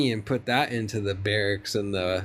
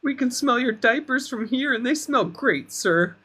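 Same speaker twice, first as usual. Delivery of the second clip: fawning sycophant; 7 semitones higher than usual. Retold or imitated. Mocking flattering aides.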